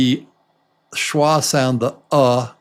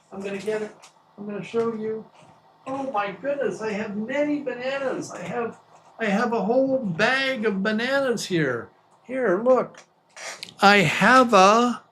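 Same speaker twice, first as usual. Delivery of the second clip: excited; 8 semitones higher, 4 dB quieter, faster than usual. Real, not acted. Goes off to fetch bananas.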